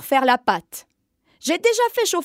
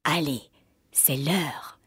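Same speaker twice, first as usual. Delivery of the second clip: low, soft whispered gloating; witch murmuring; 10 semitones lower, 7 dB quieter than usual. Acted. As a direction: whisper it hungrily over the sleepers. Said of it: "et elle murmura".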